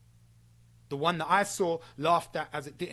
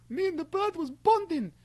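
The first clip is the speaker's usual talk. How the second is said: much higher, sneering mimic; mock sappy whine; mocking quote; 13 semitones higher than usual. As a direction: parrot him as a sappy fool. Mocking the friend again.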